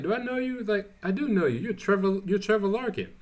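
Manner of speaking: high, mock-surprised voice of a woman figuring out who he is; recognizing voice. Grounds lady recognizing Trevor, in a high mock-surprised voice. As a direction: pitch it way up, like a sudden realization.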